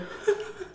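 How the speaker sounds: short high breathy laugh. Real, not acted. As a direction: laugh softly at your own story. Laughs at own story.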